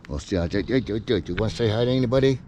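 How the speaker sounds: fast, sing-song voice for the dog; pet talk. Real, not acted. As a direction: coax the dog to greet everyone. Talking to his dog.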